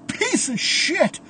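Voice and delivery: high, drawn-out strained cry; pained cursing. A narrator's reading. Andy curses Caleb after hitting the wall, high pained yell.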